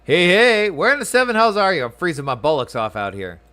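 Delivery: high, loud soldier calling out; gruff guard yelling. Acted. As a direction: bellow for your late comrade. Voices shouting guard.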